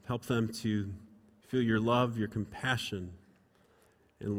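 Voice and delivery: low, softened pastoral prayer. Real, not acted. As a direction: pray quietly for a grieving family. Leads congregation in prayer.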